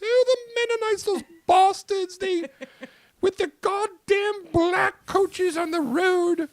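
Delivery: put-on very high, loud, slow falsetto old lady. Host teases co-host's mother in a high slow old-lady falsetto. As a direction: croon at her like a doting old biddy. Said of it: "do Mumsy".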